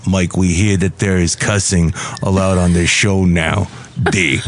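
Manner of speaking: low comic parking-attendant character; Valentino. Acted. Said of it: "This is Valentino, the parking attendant".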